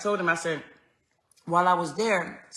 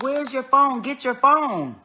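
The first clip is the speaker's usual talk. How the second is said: urgent call; 5 semitones higher, 6 dB louder than usual. Retold or imitated. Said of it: "I'm like".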